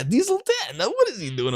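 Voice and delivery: very high, squeaky villain voice; Diesel Ten voice. Retold or imitated. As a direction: snap out the name like the cartoon engine. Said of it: "then he came up with".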